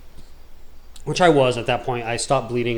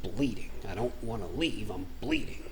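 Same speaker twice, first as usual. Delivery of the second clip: barely voiced, much softer words through a held throat; pained, much softer mumble like a hurt throat; strained held-throat talk; 3 semitones lower, 11 dB quieter than usual. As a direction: speak while holding the throat still, hardly any voice. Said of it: "I kinda hold it and I start talking like".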